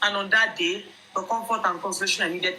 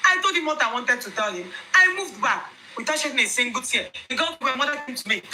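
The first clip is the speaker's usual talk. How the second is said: plain reading voice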